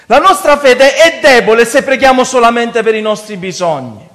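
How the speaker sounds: forceful, high-energy sermon; preaching. Real, not acted. Preacher warning brothers about weak faith, preaching. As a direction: drive the warning home to the congregation.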